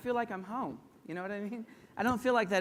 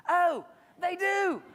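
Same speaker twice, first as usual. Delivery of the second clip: much higher, louder, slow and drawn out; spring the obvious answer on them; emphatic reveal; 8 semitones higher, 5 dB louder, much slower than usual. Real, not acted. Ironic reveal to audience.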